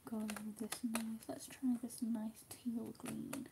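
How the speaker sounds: soft whispering while colouring. Real, not acted. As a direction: whisper to yourself as you work. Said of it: "crafting quietly".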